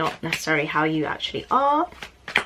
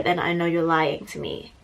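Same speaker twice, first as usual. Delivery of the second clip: ordinary delivery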